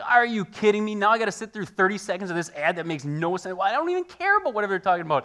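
loud, high, fast indignant outburst; exasperated rant. Retold or imitated. Pastor venting at an unskippable YouTube ad, loud exasperation. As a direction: rant at the ad you can't skip.

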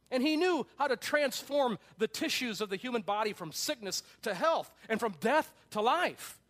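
loud, high, emphatic sermon reading; preacher proclaiming at full force; forceful preaching. Acted. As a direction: build the list of wonders with rising fervor. Preaches a book quotation at force.

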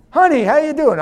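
wife's high sweet voice. Retold or imitated. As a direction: play the cheerful wife. Wife's voice acted.

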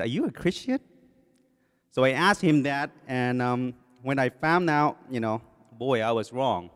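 ordinary delivery

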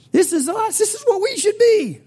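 soaring high, loud exultant preaching; ecstatic preaching. Real, not acted. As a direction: lift it into a joyful climax. Preaching climax about Grammys.

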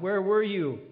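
loud, high, accusing question to God; angry cry. Retold or imitated. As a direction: demand an answer from God. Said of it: "cried out to God and even was angry".

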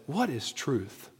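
very soft, slow, low question; hushed question. Acted. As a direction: let it drop to a slow, musing near-murmur. Quoting Pilate's question with relish.

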